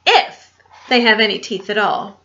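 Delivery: ordinary delivery, nothing marked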